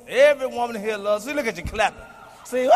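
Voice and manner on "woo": high mocking "woo"; mock whoop. Comic mocks women in the audience clapping, high mock whoop.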